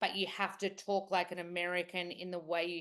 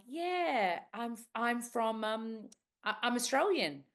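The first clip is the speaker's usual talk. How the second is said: higher, hesitant retold answer; awkward polite reply; 5 semitones higher, 3 dB louder, slower than usual. Teacher answering a curious client, higher and hesitant. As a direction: act out a halting, pitched-up reply.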